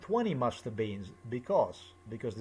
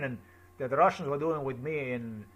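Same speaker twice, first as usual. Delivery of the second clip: ordinary talk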